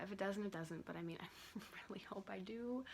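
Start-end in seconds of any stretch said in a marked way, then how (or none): 1.6-2.8 whispering. Real, not acted. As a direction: whisper it like a secret wish. Hopeful aside about getting in.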